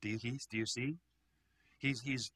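normal talking voice

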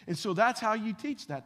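ordinary talk, nothing marked

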